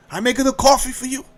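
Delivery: high, quick mock Italian accent; imitated Italian hostess accent; broken-English Italian accent. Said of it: "a broken English conversation".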